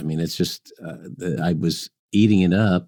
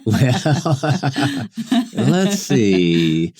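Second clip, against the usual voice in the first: real laughter breaking into the answer; laughing; 7 semitones higher, much slower than usual. Real, not acted. Laughs at OSU question.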